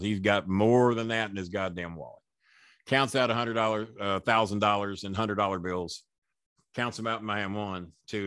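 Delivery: usual speaking voice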